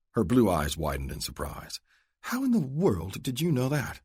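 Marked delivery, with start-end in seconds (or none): 2.2-4.0 lightly higher lady's voice, amazed; a refined lady caught off guard; Adele, surprised. Acted. Adele asks Luke how he guessed, surprised.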